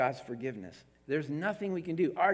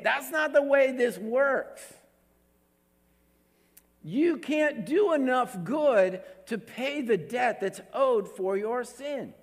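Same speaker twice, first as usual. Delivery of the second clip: slow, higher, firm correction; voice of God; 7 semitones higher, 4 dB louder, slower than usual. Retold or imitated. Preacher voicing God correcting the bargainer, slow and firm. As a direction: lay it down slowly as God. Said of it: "And God is saying".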